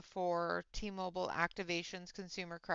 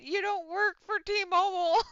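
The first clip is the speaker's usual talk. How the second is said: higher, louder mock trainer correction; trainer's retort; 10 semitones higher, 7 dB louder than usual. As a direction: lightly play the amused trainer. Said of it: "My trainer looked at me and went".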